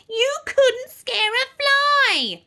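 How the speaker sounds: high, loud, drawn-out jeer; mock the pupil like a gleeful witch. A narrator's reading. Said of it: "mocked the teacher".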